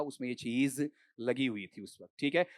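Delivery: ordinary delivery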